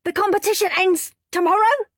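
high, loud disbelieving question; shocked. A narrator's reading. Henry shocked the contest ends tomorrow, loud. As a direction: cry out in dismay.

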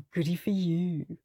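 soft, dismissive mock praise; sarcastic. A narrator's reading. Henry brushing off his boasting brother, sarcastic. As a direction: brush him off with a sneer. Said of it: "said Henry".

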